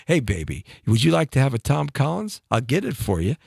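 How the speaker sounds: low, smooth bar pickup line. Retold or imitated. A guy at the bar chats up a girl, low and smooth. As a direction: croon it low like a lounge smoothie. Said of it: "I bet you used that line on girls".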